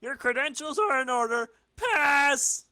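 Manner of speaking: high, stiff gatekeeper building to loud; officious guard barking Pass. Acted. Guard voices a character.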